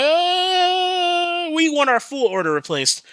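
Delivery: long high-pitched "uh" into a complaint; mocking customer whine. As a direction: imitate a whiny, demanding customer. Mimics whining customers' demand.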